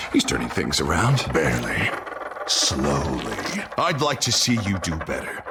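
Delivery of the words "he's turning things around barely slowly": low, cold second-personality voice; a low menacing inner voice; sinister alter ego. Acted. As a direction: let a darker self take over the mouth. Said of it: "You're acting strange, Harvey".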